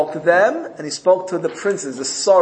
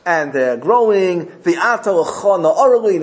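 ordinary delivery

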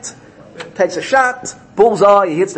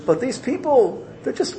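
defender; 12 semitones higher than usual. Retold or imitated. Defender pleading for people who couldn't sit still, high plea. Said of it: "the defender comes and says".

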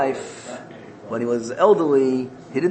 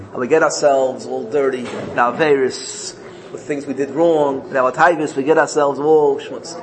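normal talking voice

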